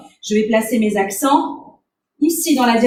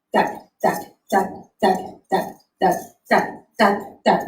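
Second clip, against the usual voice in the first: evenly spaced, lower-pitched "tac" on each beat; rhythmic beat imitation; 5 semitones lower, 3 dB quieter than usual. Shown as a demonstration. Dance teacher showing where the accents fell, rhythmic beat imitation. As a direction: tap out the rhythm with short "tac"s.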